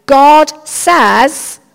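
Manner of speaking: pompous proclamation. Shown as a demonstration. Showing the congregation the wrong way to give a word, loud and pompous. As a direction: announce it with mock authority. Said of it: "We don't ever say".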